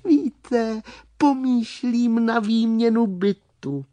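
small high-pitched creature; high little storybook voice; little worm voice. Acted. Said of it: "usměvavý červík a pokračoval".

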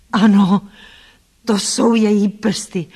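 loud exclamation of recognition; crying out. A narrator's reading. Blind man recognises her fingers by touch, crying out. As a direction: cry out, stretching the words. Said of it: "vykřikl".

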